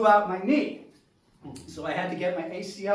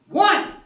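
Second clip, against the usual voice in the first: "One!" shouted high and loud; emphatic "One!"; 10 semitones higher, 10 dB louder than usual. Acted. Emphatic count of the catch.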